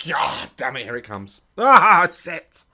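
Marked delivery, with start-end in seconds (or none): 1.6-2.6 loud wince, ah shit; loud pained yelp at the screen; pained outburst. Real, not acted. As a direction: blurt it out at the gory clip. Reacting to drilling footage.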